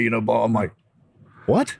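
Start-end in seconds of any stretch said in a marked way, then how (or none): 1.5-1.8 sharp, much higher disbelieving "What?"; surprised. Retold or imitated. To Bob, learning it was a setup, shocked. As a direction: jump up in pitch in shock. Hearing Bob admit the mind games.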